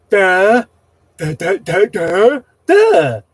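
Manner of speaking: loud, high, dopey "duh" grunts; mock moron speech. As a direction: ham up a dimwitted leader talking. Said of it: "it should have been like this".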